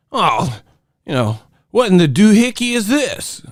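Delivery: high-pitched parody of an outraged pundit; high, indignant caricature; mock old-media complainer. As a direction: complain in a silly high put-on voice.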